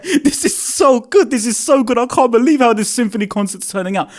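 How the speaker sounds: high, gushing retold reaction; giddy excitement. Fan geeking out while trying to tweet, giddy excitement. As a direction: gush as if hands are shaking with excitement. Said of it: "My, my hands are shaking".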